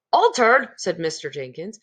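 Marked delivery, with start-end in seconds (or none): 0.1-0.7 higher and louder, startled echo; surprised. Acted. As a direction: bounce the word back in shock. Mr Jenkins shocked echo.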